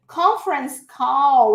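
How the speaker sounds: "Conference call" slow and loud, with a clear final L; "call" held long, L sounded; model for repetition. Shown as a demonstration. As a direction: say "Conference call" for students to repeat.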